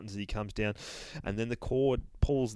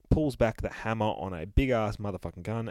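plain reading voice

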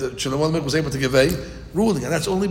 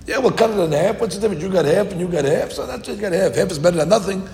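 scoffing rabbis; 5 semitones higher, 4 dB louder, faster than usual. Retold or imitated.

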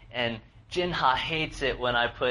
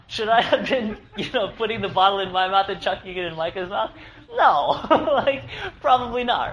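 higher and louder, laughing through the words; 9 semitones higher, 7 dB louder than usual. Real, not acted. Pastor confessing to the congregation, laughing at himself. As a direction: laugh at yourself as you admit it. Laughing at his own mistake.

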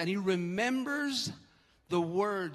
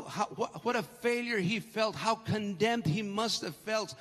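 ordinary talk

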